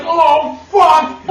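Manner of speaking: can't hold back the curse; mock pained yelp; retold yelp. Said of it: "I couldn't hold it".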